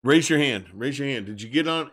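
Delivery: ordinary talk, nothing marked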